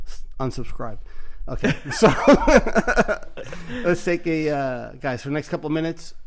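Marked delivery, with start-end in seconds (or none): 2.1-3.8 loud laugh. Real, not acted. Laughing while wrapping up the show.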